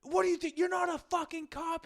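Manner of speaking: high mock yell of a furious driver; mimicking a Revere driver's high, raging scream; angry old guy. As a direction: imitate the Jeep driver screaming. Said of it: "he's just screaming at him".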